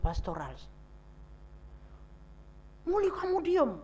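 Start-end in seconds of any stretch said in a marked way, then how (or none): 2.9-3.8 scolding relative. Retold or imitated. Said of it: "Orang marahin saya".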